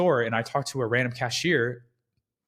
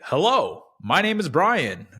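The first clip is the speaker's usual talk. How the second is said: fake salesy voice; 5 semitones higher, 3 dB louder than usual. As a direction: perform the polished rep persona. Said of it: "this mask that's obviously very clear".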